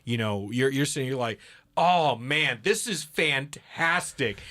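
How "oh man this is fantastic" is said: mock enthusiasm. Mocking a swayed listener.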